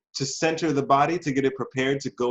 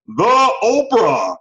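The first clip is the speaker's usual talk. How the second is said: "the Oprah" drawn out slowly, much higher and louder, like an announcer; hammed-up celebrity name; 9 semitones higher, 9 dB louder, much slower than usual. Shown as a demonstration. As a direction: stretch "the Oprah" out big and grand for a joke. Repeats the Oprah with flourish.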